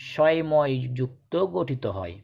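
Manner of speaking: usual speaking voice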